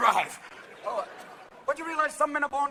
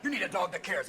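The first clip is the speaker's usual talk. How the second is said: ordinary talk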